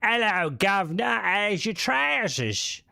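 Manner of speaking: high, slow, hammed-up posh British toff; mock a stuffy upper-class Englishman in a posh British accent; exaggerated posh British accent, drawn out and haughty; pompous British mock. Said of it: "just because you're one of them, like, pompous".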